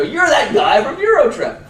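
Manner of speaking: high, loud, slow fanboy gush; mock fan squeal, high and loud; excited fan. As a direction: starstruck fan calling out. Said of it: "They go".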